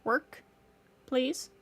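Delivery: ordinary talk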